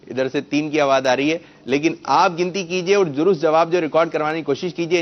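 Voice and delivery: ordinary talk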